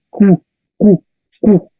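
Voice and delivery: Q as "kw", u sounded, repeated separately; Latin QU. Shown as a demonstration. Teacher drills the Latin QU sound, kw. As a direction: sound out each Q as "kw". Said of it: "en latín esto se pronuncia Q".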